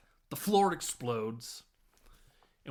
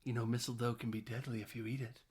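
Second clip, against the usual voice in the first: sultry line; 3 semitones lower, 7 dB quieter than usual. Retold or imitated. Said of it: "she hits us with".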